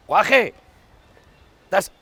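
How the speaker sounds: loud, high shout; calling out. Acted. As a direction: holler sharply to get a kid's attention.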